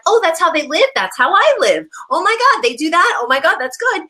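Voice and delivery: high, excited imitated gasp of wonder; amazed discovery. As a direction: play the amazed viewer discovering another home. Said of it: "whatever, it's like".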